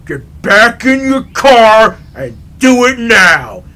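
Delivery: slow, loud booming order; imitated big man's command. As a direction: order him off like a huge enforcer. Huge man's menacing command.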